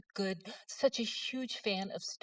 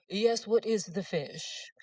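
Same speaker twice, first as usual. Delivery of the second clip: slow, haughty put-on question; snobbish jerk; 2 semitones higher, 4 dB louder, much slower than usual. To the waitress, slow and haughty snob voice. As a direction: drag it out like a snob talking down. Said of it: "Now, I was being a jerk".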